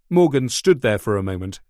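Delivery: usual speaking voice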